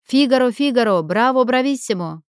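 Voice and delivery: loud, high operatic exclaiming; mock operatic. A narrator's reading. Olenka clowning with her uncle's operetta lines, mock operatic. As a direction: belt it out like a comic opera buff.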